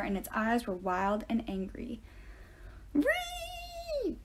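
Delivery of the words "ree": long, very high shrill boar squeal; high piercing animal squeal; pig squeal. A narrator's reading. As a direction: squeal like a giant angry pig. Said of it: "it squealed".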